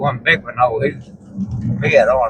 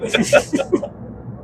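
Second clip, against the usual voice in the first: breathy laugh; 5 dB quieter than usual. Real, not acted. Laughs after the comeback.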